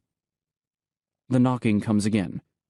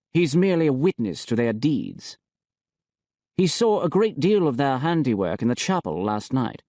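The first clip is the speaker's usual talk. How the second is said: a hint of the bishop, smug and cool; the bishop reporting to the high priest, lightly voiced; 5 semitones higher than usual. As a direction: bishop bringing bad news, lightly raised. Niravati voiced in the story.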